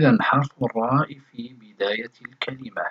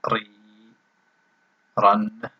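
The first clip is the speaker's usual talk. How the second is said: ordinary talk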